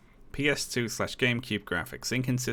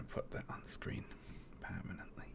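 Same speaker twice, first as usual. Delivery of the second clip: whispering; 5 semitones higher, 19 dB quieter than usual. Real, not acted. Streamer muttering while moving overlay.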